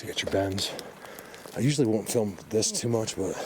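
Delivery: usual speaking voice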